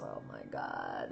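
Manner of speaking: very low, slow, drained groan; bored casting director. Retold or imitated. Casting director tired of identical auditions, a very low slow groan. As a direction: sink into weary boredom. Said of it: "the casting director is going like this".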